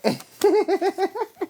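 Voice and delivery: loud, high burst of laughter. Real, not acted. Cracking up at her own joke, high laugh.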